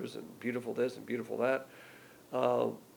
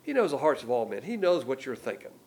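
ordinary talk

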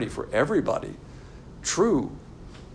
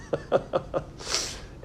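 breathy laugh; 2 semitones higher, 6 dB quieter than usual. Real, not acted. Laughs after the chant.

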